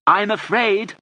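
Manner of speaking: high, loud roar. A narrator's reading. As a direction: bellow it to a deaf listener. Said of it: "roared Alleyne".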